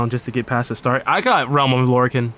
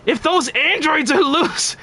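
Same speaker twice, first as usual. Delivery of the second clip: high, slow frantic warning; panicked Trunks; 12 semitones higher, slower than usual. Acted. Streamer voicing Trunks' panicked android warning, high and frantic. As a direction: squeal it in terror. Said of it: "you want me to do Trunks?".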